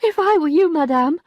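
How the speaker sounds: high-voiced maid, a touch of a sigh; lightly voiced maid giving advice. A narrator's reading. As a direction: maid gently advising her mistress, high voice. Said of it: "sighed Félicité at last".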